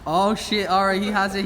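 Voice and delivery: ordinary talk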